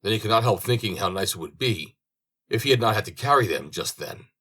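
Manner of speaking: plain reading voice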